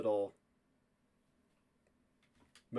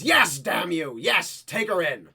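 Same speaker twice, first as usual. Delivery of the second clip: loud, exasperated outburst; angry order; 5 semitones higher, 8 dB louder, slower than usual. Acted. Soldier shouting at the woman to take Liv in. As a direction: snap the command at her.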